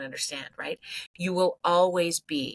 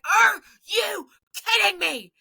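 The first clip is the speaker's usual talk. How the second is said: much higher and louder, slow mock outrage; exasperated outburst; 7 dB louder, much slower than usual. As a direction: replay the fed-up coach slamming the bench door, high and loud. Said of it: "I'm like".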